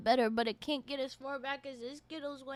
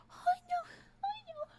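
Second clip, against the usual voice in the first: high-pitched whine; 4 dB quieter, slower than usual. Real, not acted. Reacting to burning candy.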